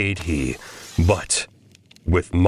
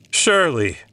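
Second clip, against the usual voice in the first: high nervous surely; 9 semitones higher than usual. A narrator's reading. Man soothing his own fear, high and nervous.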